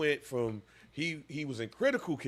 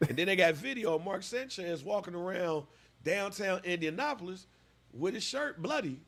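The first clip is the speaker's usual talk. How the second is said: normal talking voice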